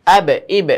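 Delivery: আব and ইব with ব released hard and loud; আব, ইব with an echoing ব; bounced qalqala. Shown as a demonstration. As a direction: knock the final ব in আব, ইব. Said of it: "এরকমভাবে".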